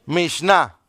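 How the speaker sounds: Mishnah set apart, stressed on the final A; Mishnah as a new word, weight on its last syllable; teaching pronunciation. Shown as a demonstration. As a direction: model Mishnah carefully for learners. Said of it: "le voy a enseñar otra palabra hebrea".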